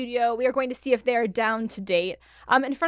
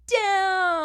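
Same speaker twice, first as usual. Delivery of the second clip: excited reveal; 9 semitones higher, 3 dB louder than usual. Real, not acted. Host reveals answer excitedly.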